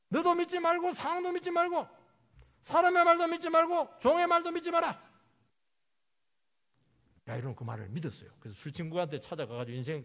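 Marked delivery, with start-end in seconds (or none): 0.1-5.0 high, loud, driving exhortation; full-force preaching. Real, not acted. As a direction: hammer out each warning at the top of the voice.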